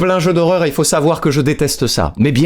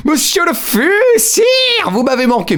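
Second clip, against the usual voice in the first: high, grand courtly welcome; mock courtly greeting; slower than usual. Streamer hails a regular viewer in a hammed-up courtly voice. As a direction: greet him like a herald at court.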